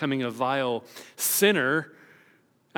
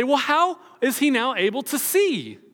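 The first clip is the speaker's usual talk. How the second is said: higher, pointed challenge; demanding question; 9 semitones higher, 4 dB louder than usual. Retold or imitated. Pharisees demanding the parents explain the healing, pitched high. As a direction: push hard for how he can see.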